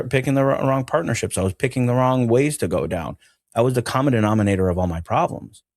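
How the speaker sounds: ordinary delivery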